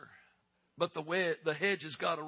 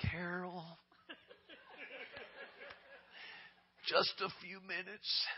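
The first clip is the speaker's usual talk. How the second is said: laughing whisper; 9 dB quieter, slower than usual. Retold or imitated. Whispering to sister in baptistry.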